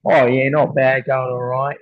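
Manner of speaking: broad Aussie reply, opening loud; hammed-up casual Australian accent. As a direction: answer like an Aussie mate. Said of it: "reply back in the same accent".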